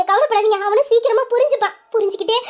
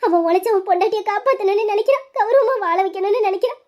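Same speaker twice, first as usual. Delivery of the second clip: ordinary delivery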